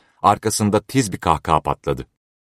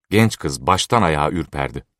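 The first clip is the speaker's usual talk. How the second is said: ordinary delivery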